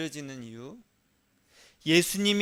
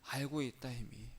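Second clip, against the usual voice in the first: softer, lower gentle voice of Jesus; Jesus answering; 5 semitones lower, 11 dB quieter than usual. Pretend. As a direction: answer like a calm, forgiving Lord, hushed. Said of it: "예수님께서 뭐라고 하셨냐".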